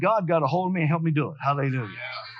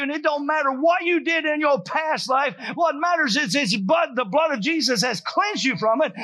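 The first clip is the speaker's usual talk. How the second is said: higher and louder fervent preaching; preaching at full force; 7 semitones higher, 4 dB louder than usual. Acted. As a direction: rouse the congregation with fire.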